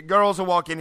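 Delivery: ordinary talk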